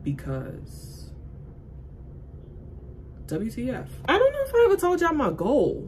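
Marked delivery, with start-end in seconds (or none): 4.1-5.8 high, loud burst of excitement; suddenly bursts out loud and high; excited outburst. Real, not acted.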